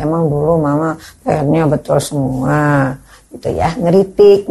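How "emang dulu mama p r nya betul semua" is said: put-on softer, slower sulky child thought; a hint of a sulking child; child's inner grumble. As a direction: mutter it as a resentful kid. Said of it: "Dalam hati anak".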